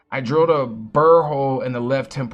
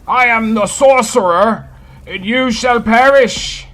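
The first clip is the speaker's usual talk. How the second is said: put-on grand mid-Atlantic accent, higher and louder; 8 semitones higher, 11 dB louder than usual. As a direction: declaim like an old-movie sorcerer in mid-Atlantic. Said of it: "With my mid-Atlantic accent".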